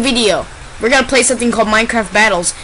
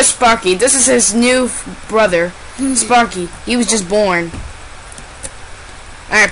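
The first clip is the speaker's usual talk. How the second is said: ordinary delivery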